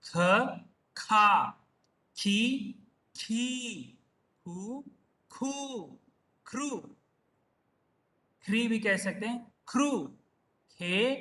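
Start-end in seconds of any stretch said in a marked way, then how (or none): 0.0-6.7 isolated syllables with pauses, long vowels held; syllable drill recitation. Shown as a demonstration. Teacher taking students through the kha row, measured recitation. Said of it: "ख का देखेंगे उसी प्रकार". 9.7-11.1 one syllable at a time, paused between; syllable drill recitation. Shown as a demonstration. Teacher finishing the kha row for students, measured recitation. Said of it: "ख का देखेंगे उसी प्रकार".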